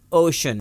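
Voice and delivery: اوشن with SH in the middle; correct SH reading. Shown as a demonstration. Teacher shows how learners read ocean, SH sound. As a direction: say اوشن as OH-shun. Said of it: "آپ اس کو پڑھتے ہیں".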